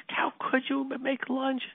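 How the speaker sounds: very high but hushed mock scream; thin, high, held-back imitation of yelling; retold mock scream. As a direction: imitate a screaming mother without the volume. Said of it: "My wife started screaming".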